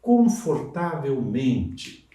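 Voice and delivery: confortavelmente stretched syllable by syllable; slow model word; very slow, drawn out. Shown as a demonstration. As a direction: lay out confortavelmente slowly for the class. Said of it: "acrescentar outro sufixo, mente".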